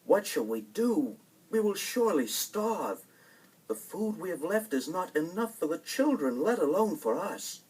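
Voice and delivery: fretful woodcutter pleading; worried father. Acted. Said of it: "he said to his wife".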